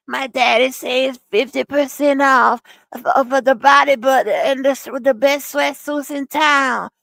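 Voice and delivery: mock him pushing a sweatsuit sale; a put-on pitchman voice selling sweatsuits; imitating his sales pitch. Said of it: "That's what he said".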